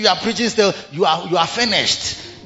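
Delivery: ordinary delivery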